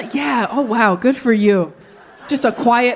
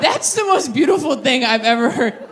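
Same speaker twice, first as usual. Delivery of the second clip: ordinary delivery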